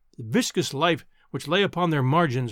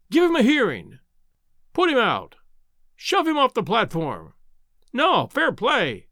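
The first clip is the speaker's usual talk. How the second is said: shouting crowd; 5 semitones higher than usual. A narrator's reading. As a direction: yell from the crowd. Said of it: "emerged from a general roar".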